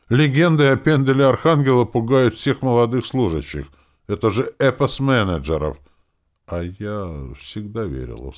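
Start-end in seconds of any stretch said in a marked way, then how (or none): 0.1-5.8 high, loud excited exclamation; exclaiming. A narrator's reading. Young woman telling a client of his legend, exclaiming. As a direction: gush loudly about a famous legend. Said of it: "воскликнула девушка".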